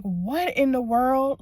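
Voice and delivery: loud, high, drawn-out bafflement; retold disbelief. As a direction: relive it, stretching the words in shock. Own stunned reaction, retold.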